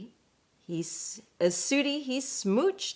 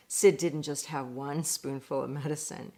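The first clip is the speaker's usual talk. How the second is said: ordinary delivery, nothing marked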